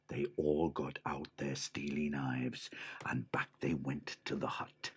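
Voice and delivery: hushed whispered narration; whispering. A narrator's reading. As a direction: whisper it sneakily. Sneaking narration.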